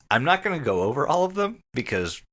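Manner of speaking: ordinary delivery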